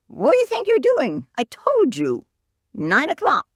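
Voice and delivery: high, exasperated mock outburst; hammed-up irritated bartender; annoyed bartender. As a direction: snap back like a fed-up bartender. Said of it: "the bartender is quite annoyed".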